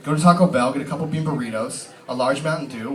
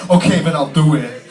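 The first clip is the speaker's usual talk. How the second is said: Christopher Walken voice; 8 dB louder than usual. Pretend. Walken quoted replying to agent.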